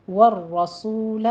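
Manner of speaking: slow والرسول with a heavy rolled ra; tajweed demo. Shown as a demonstration. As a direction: roll the ra of والرسول twice, heavy. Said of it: "صرف دو بار تکرار ہوگا".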